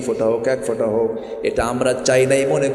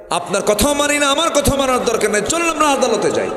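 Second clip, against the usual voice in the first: defiant horse seller; 12 semitones higher than usual. Acted. A common horse seller defying the caliph in a very high voice. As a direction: push back boldly at the caliph. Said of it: "একজন সাধারণ ঘোড়া বিক্রেতা বলতেছে".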